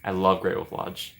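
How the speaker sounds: normal talking voice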